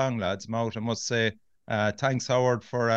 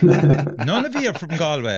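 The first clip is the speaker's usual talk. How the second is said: laughing disbelief, louder; laughing in surprise; 5 semitones higher, 7 dB louder than usual. Real, not acted. Host learns neither is from Galway.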